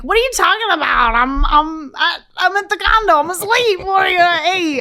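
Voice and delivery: put-on loud, high, slurry drunk denial; drunk and mean. Teen caught by mom, answering drunk and mean. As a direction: snap back defensively while drunk. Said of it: "You sounded mean".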